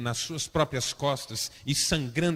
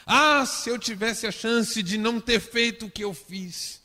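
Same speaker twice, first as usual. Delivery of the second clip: higher, complaining self-pity, blaming the wife; mock whining lament; 7 semitones higher, 4 dB louder than usual. Pastor playing a man blaming his wife, whiny. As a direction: wail the regret like a sulking husband. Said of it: "Você já disse isso alguma vez?".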